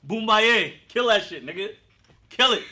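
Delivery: loud, high hype-man chant; cheering on. Acted. Father cheering on his ex's new man, loud hyped cheer. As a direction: yell it like ringside encouragement. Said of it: "cheer him on".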